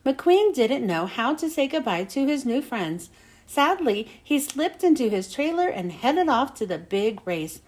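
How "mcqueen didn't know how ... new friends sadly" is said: ordinary delivery, nothing marked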